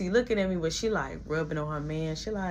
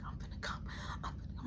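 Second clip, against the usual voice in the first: whisper; 14 dB quieter, faster than usual. Retold or imitated. Whispering mid-scene.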